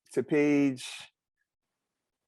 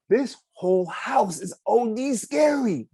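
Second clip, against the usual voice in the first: excited; 11 semitones higher, 4 dB louder than usual. Acted. Dan reacting in awe.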